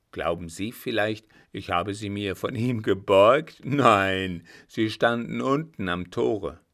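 put-on blunt old soldier, loud and emphatic; indignant old marshal. Marshal denying he borrowed the boots, loud indignation. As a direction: swell into a loud, mock-affronted denial.

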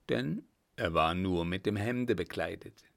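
usual speaking voice

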